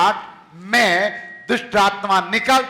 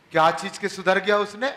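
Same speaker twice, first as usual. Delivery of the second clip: ordinary talk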